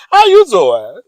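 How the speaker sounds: high, very loud, rising question; loud flirty come-on. Shown as a demonstration. Playful greeting for the man she fancies, loudly flirtatious. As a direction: belt out a playful pickup line.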